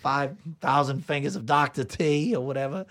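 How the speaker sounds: high, theatrical actor impression; name the film as a grand character actor; Hans Conried. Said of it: "there's some Hans Conried in there".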